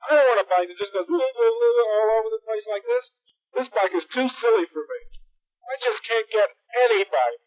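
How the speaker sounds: hammed-up tearful whine; whiny crying kid. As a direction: whine it like a crying little boy. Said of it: "Hieronymus started crying".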